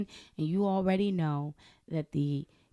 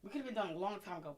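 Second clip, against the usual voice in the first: normal talking voice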